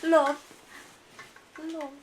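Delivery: love in a mild British accent. Shown as a demonstration. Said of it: "say it in the British".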